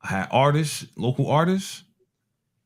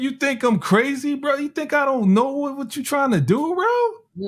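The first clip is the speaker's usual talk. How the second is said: pitched way up, incredulous; indignant high voice; 13 semitones higher than usual. Retold or imitated. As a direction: scoff like it's obvious. Indignant inner rant, pitch high.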